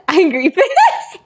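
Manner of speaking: giggly mock-angry reading; mock angry, laughing. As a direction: play angry and fail with a laugh. Repeats angry face, breaks into laughter.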